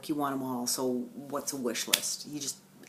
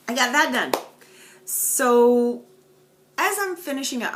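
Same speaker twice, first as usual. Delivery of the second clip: higher and louder, bubbling over; excited; 8 semitones higher, 8 dB louder than usual. Real, not acted. Vlogger telling viewers she finished a piece, excited. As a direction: burst out with pride.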